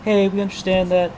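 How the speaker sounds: sweet on top, impatient underneath; mock fake sympathy. Mocking pushy exhibit people.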